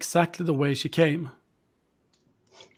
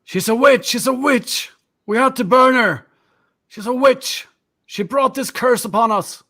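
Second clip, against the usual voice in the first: high, loud zealous shouting; fanatic villager; 8 semitones higher, 3 dB louder than usual. Acted. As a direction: shout like a mob defending the burning. Villagers justify burning.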